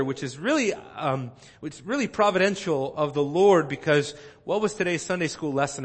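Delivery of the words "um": ordinary talk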